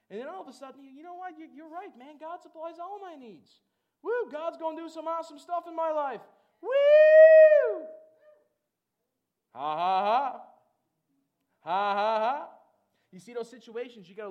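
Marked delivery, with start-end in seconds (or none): 0.1-6.2 high-pitched, rising excitement; bubbling joy. Acted. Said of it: "joy just starts bubbling up". 6.6-7.8 very loud, high "woo"; joyful whoop. Acted. Acting out joy bubbling up. 9.5-12.5 two booming bursts of ha-ha; loud forced laugh. Shown as a demonstration. Demonstrating laughter at situations.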